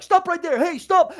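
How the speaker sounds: high, loud command to halt; guard's shout. Acted. Guards rush two thugs in the yard, shouting. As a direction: yell it as you rush at them.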